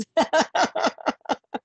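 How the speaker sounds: high giggling peal of laughter; laughing. Real, not acted. Host amused by how the guest looked at her, laughing. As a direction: burst out in light, high laughter.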